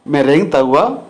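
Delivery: normal talking voice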